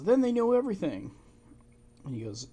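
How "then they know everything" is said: much higher, alarmed cry; alarmed realization. Retold or imitated. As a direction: mimic her panic at being found out. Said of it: "And she goes".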